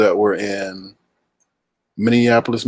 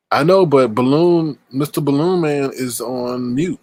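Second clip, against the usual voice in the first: usual speaking voice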